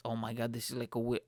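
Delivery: ordinary talk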